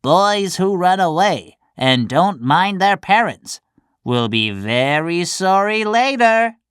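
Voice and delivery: cricket warning. Acted. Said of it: "The cricket said".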